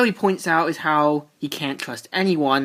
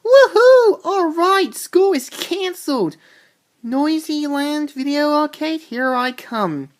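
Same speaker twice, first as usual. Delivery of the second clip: shouted high whoop, then pitched-up glee; gleeful cheer; 10 semitones higher, 4 dB louder than usual. Retold or imitated. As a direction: cheer like a kid freed from school. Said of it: "Bart's like".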